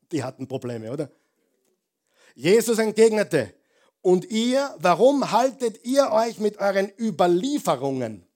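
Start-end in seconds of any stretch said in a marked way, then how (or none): 2.3-8.3 loud, high preacher's climb; rising into a fervent, loud sermon peak; full-force preaching. Acted. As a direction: drive it home to the congregation. Preacher proclaiming Jesus' rebuke.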